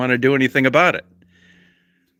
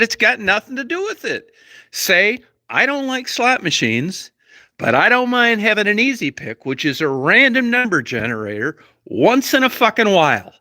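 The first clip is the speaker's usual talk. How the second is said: angry yelling; 10 semitones higher than usual. Retold or imitated. Reliving a fight with his wife over lottery tickets, yelling angrily. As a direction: shout him down in a heated argument. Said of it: "you don't need to talk to me in that tone of voice".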